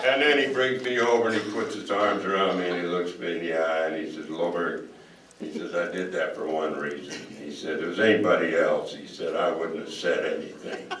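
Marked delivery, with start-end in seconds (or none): 4.3-4.9 gruff coach voice. Retold or imitated. Coach voiced in retold story. 5.7-7.2 gruff coach voice. Retold or imitated. Coach voiced in retold story. 7.8-8.9 gruff coach voice. Retold or imitated. Coach voiced in retold story. 9.4-10.9 gruff coach voice. Retold or imitated. Coach voiced in retold story.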